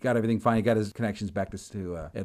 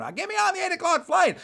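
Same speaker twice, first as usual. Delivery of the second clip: high-pitched insistent outburst; agitated demand; 4 dB louder than usual. Retold or imitated. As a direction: act out a pestering, worked-up traveler. Joe demanding amid the yelling.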